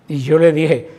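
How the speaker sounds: ordinary talk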